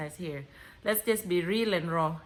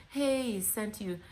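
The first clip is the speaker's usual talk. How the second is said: usual speaking voice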